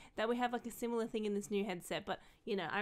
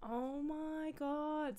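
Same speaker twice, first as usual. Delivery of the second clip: high, soft, very slow; drawn-out hushed squeal; awed delight; 4 semitones higher, 5 dB quieter, much slower than usual. Real, not acted. Creator lifting the new headset, softly awed.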